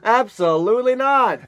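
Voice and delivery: high, slow, flat refusal; carnival barker. Retold or imitated. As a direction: shut them down, carny-style. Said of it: "Carnival Barker".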